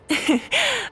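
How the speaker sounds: short high amused giggle; light chuckle. Acted. Archon amused by companion's summary.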